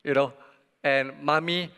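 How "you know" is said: ordinary talk, nothing marked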